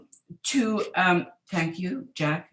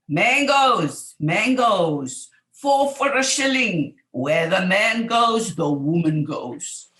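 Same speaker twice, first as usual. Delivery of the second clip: beach vendor's call; 2 semitones higher, 7 dB louder, slower than usual. Retold or imitated. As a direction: call out your mangoes across the beach. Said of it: "who would shout".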